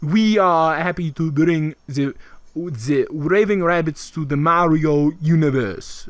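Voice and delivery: put-on slow, halting French-accented English; hammed-up French executive's broken English; terrible French accent. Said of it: "oh, that's a terrible French".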